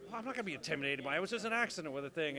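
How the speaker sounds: rushed, defiant chatter; fast defiant. Retold or imitated. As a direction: blurt it out fast. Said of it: "And then I was like".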